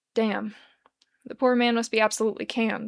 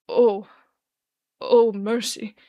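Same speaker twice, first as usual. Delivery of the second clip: put-on louder, slurring drunk gasping in horror; slurred horror; 6 dB louder, much slower than usual. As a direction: let the horror spill out half-drunk. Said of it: "slurs with the sound of absolute horror".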